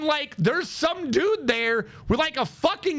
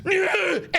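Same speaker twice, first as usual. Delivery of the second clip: higher mock troll snarl; troll growl; 7 semitones higher than usual. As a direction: imitate a troll's growling grunt. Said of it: "And the troll goes".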